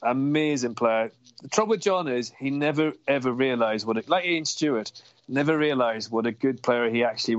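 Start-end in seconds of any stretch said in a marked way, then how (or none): none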